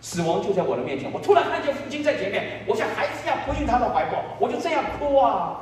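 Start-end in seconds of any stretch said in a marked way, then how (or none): 1.1-5.6 high, fervent retelling; impassioned testimony. Real, not acted. Preacher recalling running into the Father's arms, impassioned. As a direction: lift the voice high as the moment breaks open.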